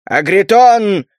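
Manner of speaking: slow booming call; magically amplified voice. A narrator's reading. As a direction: call the name like thunder. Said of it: "громом прокатился усиленный метаморфизмом голос". (громовой оклик)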